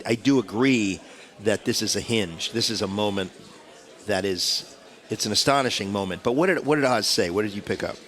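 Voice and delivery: ordinary talk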